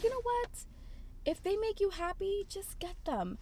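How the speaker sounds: high, softened cashier voice; retold gentle, high-pitched persuasion; persuasive shop girl. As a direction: imitate a friend coaxing you to treat yourself. Voicing shop girl persuading her.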